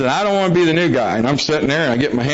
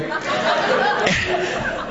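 breathy laugh; 4 dB quieter than usual. Real, not acted. Audience joke lands.

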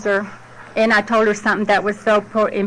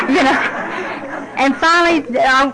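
laughing; 4 semitones higher than usual. Real, not acted. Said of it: "laugh heard on the line".

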